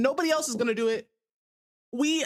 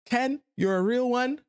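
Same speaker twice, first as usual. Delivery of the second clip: ordinary delivery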